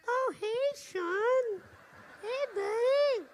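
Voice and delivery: high, slow put-on innocent greeting; innocent president. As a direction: chirp it sweetly, as if nothing happened.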